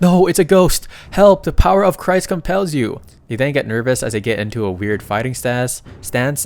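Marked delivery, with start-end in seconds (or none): 0.0-3.0 much higher and much louder terrified shriek; panicked scream. Acted. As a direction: scream it like you've seen a ghost. Brothers panic at supposed ghost.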